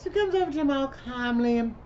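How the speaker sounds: ordinary delivery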